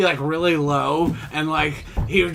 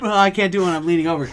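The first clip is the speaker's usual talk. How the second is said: normal talking voice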